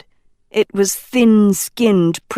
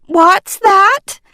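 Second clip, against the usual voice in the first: loud, high call straining to hear; calling back; 11 semitones higher, 5 dB louder than usual. A narrator's reading. A professor calling up to a student through the din, loud and high. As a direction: shout back over the noise. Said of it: "Dovey said, hand to ear".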